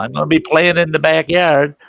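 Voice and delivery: calling out. Retold or imitated. Kid telling Mom where he'll be, calling out. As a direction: holler it as a kid to a parent.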